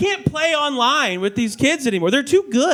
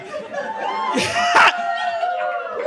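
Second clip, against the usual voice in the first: high shrieking laugh; 4 dB quieter than usual. Real, not acted. Big laugh.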